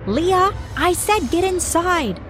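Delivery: high, slow furious yell; mother screaming at daughter to come in. A narrator's reading. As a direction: shriek the order from the window. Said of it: "my mom screamed".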